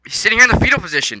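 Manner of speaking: worked-up repeat; exasperated. Shown as a demonstration. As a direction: say it again in disbelief.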